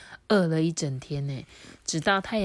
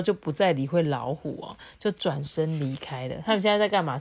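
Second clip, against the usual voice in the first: usual speaking voice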